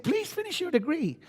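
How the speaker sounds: lightly voiced mother begging; a hint of a mother's plea. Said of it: "My mother said".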